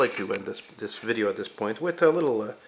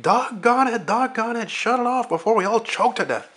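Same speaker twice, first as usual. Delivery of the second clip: high-pitched, loud junkyard boss yelling at a smoking car; Darnell impression; 14 semitones higher, 8 dB louder than usual. Junkyard owner Darnell yells at Arnie's smoking car, high and loud. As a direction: squeal it out like a cranky old junkyard owner. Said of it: "And, and Darnell's like".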